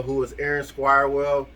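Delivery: normal talking voice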